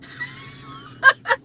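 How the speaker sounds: very high squealing laugh; helpless laughter. Real, not acted. Woman broke her duster, squealing laughter.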